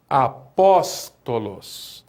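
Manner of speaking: drawn-out classroom model of Apóstolos. Shown as a demonstration. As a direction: say Apóstolos slowly and low, leaning on pó. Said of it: "Onde que está o acento?".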